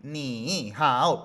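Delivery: "ni hao" with each syllable dipping and rising; awkward unchanged tones on NI and HAO; two full third tones. Shown as a demonstration. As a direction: drag "ni" and "hao" each down then up. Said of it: "pero se escucha feo".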